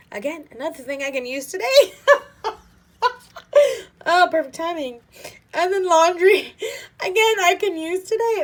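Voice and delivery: high, loud, laughing through the words; giddy laughter at the timing; laughing delight. Real, not acted. Vlogger laughs at gifts she can use today, loud giddy laughter.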